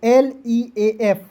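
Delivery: L ई A F spelled slowly, letter by letter; slow classroom spelling drill. Shown as a demonstration. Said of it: "उच्चारण बड़ी ई के रूप में".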